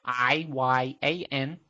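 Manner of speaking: slow, letter-by-letter spelling; dictating. Real, not acted. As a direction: spell each letter out clearly. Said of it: "I'll spell it out for you".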